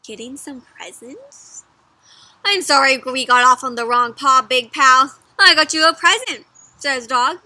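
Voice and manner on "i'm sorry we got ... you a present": loud, eager dog character; eager apology. A narrator's reading. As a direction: make up with your pal, loudly. Dog makes up, offering gift.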